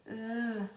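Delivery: "Z" as a soft sustained buzz; demonstrated Z sound. Shown as a demonstration. Teacher showing the Z sound alone, soft held buzz. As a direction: buzz the Z, no vowel. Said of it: "tem um som de Z".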